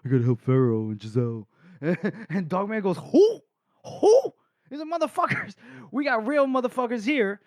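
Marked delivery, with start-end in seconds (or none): 0.0-1.5 low, dopey mock voice; dumb guy. 3.0-7.4 very high, hooting scorn; hammed-up falsetto disbelief; mock incredulous. Acting Dogman's scornful outburst.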